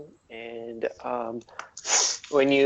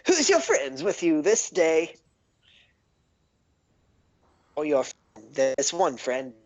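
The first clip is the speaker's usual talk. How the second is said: excitable gnome; 5 semitones higher than usual. Acted. Gnome eyes the party.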